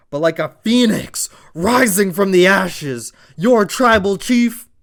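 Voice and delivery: loud, high hype build, slowing on the title; hype announcer. Acted. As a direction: call it like a ringside announcer. Hyping the champion's comeback.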